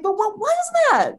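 very high-pitched incredulous squeal; amazed questioning. Retold or imitated. Client asking healer about strange feeling, amazed. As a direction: act out being totally floored.

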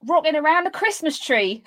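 high-pitched put-on Cockney; Cockney accent. Shown as a demonstration. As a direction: perform it in a bold, lifted Cockney accent. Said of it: "How would you, how would you say this sentence".

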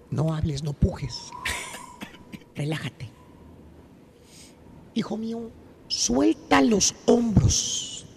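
hypnotist voice. Acted. Maestro hypnotizing the turqui, soft hypnotist voice. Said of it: "Te voy a hacer como la de Get Out".

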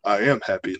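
low deadpan mimicry; C J's monotone. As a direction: imitate an emotionless dad claiming joy. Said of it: "he just monotone through life".

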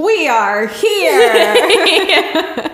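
loud, high, very drawn-out announcement; mock spirit greeting. Joking about greeting the spirit box, loud hammed-up call. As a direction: stretch it out theatrically through laughter.

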